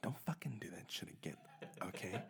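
whispered scolding. Pretend. Coworker warning him quietly, whispered scolding. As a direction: hiss it under your breath.

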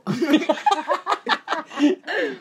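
high burst of laughter. Real, not acted. Laughs at joke.

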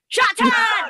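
high, loud cartoon shout; hammed-up character cry. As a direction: yell it like a cartoon raccoon. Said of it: "Rocky Raccoon says".

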